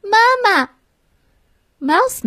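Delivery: high call for mother. A narrator's reading. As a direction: shout up for Mom. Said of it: "然后她大叫".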